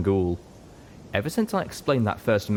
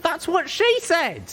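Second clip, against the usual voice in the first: very high, slow punchline; squeaky joking voice; 4 dB louder, slower than usual. A narrator's reading. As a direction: deliver the cheeky joke. Character voice.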